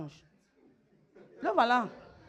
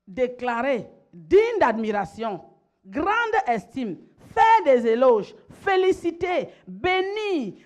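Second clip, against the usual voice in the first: loud, slow list building higher; ringing pulpit declamation, word by word; emphatic preaching; 4 semitones higher, 6 dB louder, slower than usual. Real, not acted. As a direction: proclaim each praise word with growing force. Preacher builds list of praise words.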